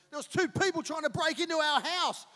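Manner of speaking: high, loud alarmed report; alarmed son retold. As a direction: blurt out the danger, loud and high. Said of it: "And he goes".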